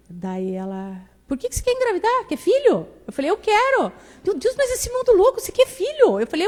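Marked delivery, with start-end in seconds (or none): 1.3-2.9 put-on high, incredulous old lady voice; high-pitched doubtful grandma; grandma's questioning voice. 3.3-3.9 small eager reply. Retold or imitated. Her younger self answering grandma. 4.2-6.2 put-on high, incredulous old lady voice; high-pitched doubtful grandma; grandma's questioning voice.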